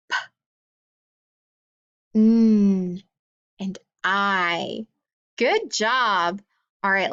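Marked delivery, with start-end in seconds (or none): 0.1-0.2 "P" as a bare puff of air; P letter sound. Shown as a demonstration. Teacher reading a sound card, voiceless "P" puff. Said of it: "make the sound". 2.1-3.0 "N" as a held nnn hum; letter sound. Shown as a demonstration. Teacher reading a sound card, "N" hummed as nnn. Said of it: "make the sound".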